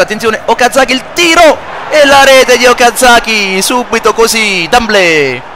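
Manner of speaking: rising excited goal call, then drawn out; goal celebration. Acted. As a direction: call the goal, stretch the scorer's name. Goal scored in commentary.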